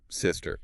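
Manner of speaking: SISTER stressed on SIS; first-syllable stress. Shown as a demonstration. Teacher modelling sister, stressed on the first part. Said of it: "Stress on the first part".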